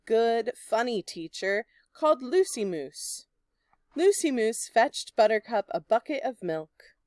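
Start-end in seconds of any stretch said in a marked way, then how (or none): none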